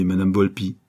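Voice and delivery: usual speaking voice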